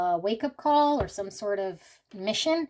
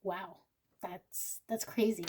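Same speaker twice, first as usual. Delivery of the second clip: choked up; 7 dB quieter than usual. Real, not acted. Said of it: "I'm feeling like crying".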